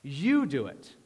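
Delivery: You drawn out with a big pitch swoop; emphatic, stretched You; stress on You. Shown as a demonstration. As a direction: land heavily on You, then drop away. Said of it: "the emphasis is on you".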